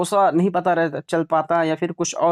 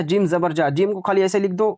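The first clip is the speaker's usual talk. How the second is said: usual speaking voice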